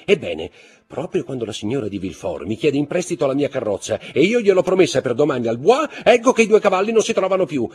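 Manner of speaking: high, loud, heated complaint; indignant accusation. A narrator's reading. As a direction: accuse him publicly, voice climbing high and loud. Baroness accuses husband of selling horses. (accusa indignata, con voce acuta)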